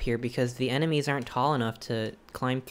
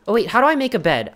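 flustered; 6 semitones higher, 8 dB louder, faster than usual. Real, not acted. Sudden panic over bed.

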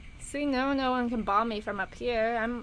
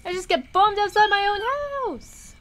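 startled yell; 9 semitones higher than usual. Real, not acted. Gamer bombed outside own house, startled yell. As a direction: cry out high as if suddenly hit.

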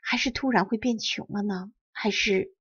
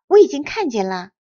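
happy; 3 semitones higher, 9 dB louder than usual. Acted. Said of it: "惠美子高兴地说".

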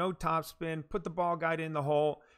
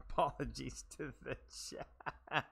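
softer, breaking into laughter; laughing apology; 4 semitones lower, 10 dB quieter than usual. Real, not acted. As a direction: try to apologise through giggles.

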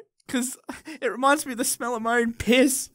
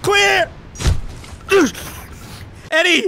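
loud urgent yell; shouting; 5 semitones higher, 4 dB louder, slower than usual. Acted. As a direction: call it like a medic. Defibrillator parody.

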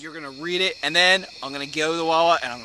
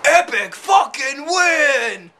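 slow, loud, drawn-out victory shout; triumphant shout; 11 semitones higher, 9 dB louder, much slower than usual. Real, not acted. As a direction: yell it out like a champion.

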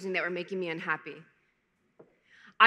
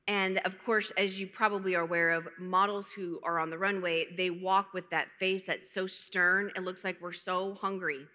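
ordinary talk

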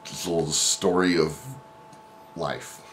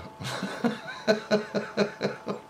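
laughing; 5 dB quieter than usual. Real, not acted. Laughing recalling it.

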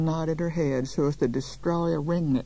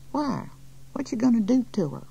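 put-on high-pitched little boy piping up; quick, high child's question; 8 semitones higher than usual. A narrator's reading. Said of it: "It was a child's voice".

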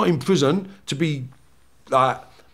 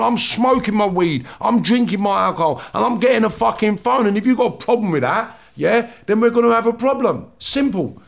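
mock-hard prison threat, slowed on key lines; retold defiant warning; 6 semitones higher than usual. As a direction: throw down the gauntlet, chest out. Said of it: "it was like".

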